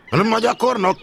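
loud, high call across the station; shouted call. Acted. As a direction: call the trainee in sharply. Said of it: "kiáltotta a főnök úr". (magas, hangos kiáltás)

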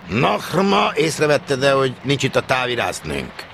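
high, loud outburst that gradually falls; blustering stationmaster. Acted. Stationmaster quizzing his trainee about the telegraphist, blustering. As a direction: burst out with the question like a boss.

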